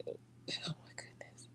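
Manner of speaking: barely audible, voiceless whisper; whispered aside. Real, not acted. Recovering after laughing over an untold story, whispering.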